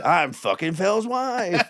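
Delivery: high, drawn-out taunting announcement; boasting improviser. Retold or imitated. Improviser taunting the comic before his audience, brazen. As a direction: announce it slowly and high to the crowd.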